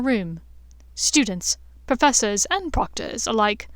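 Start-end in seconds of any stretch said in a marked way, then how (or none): none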